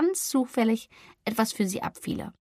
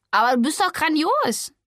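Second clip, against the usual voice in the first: higher, louder indignant call; indignant outcry; 6 semitones higher, 4 dB louder than usual. Acted. Hotte outraged that Flori was rejected, an indignant cry. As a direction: protest hotly on her behalf. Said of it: "rief Hotte empört".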